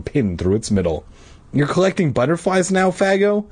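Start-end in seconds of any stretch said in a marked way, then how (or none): 1.5-3.4 high, mildly surprised question. A narrator's reading. Danny to Todd about his hobby, surprised. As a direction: pitch up into a question of mild surprise. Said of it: "Danny asked in surprise".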